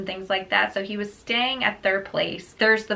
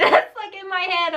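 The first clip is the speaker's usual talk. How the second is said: laughing; 8 semitones higher than usual. Real, not acted. Laughing at spear guard image.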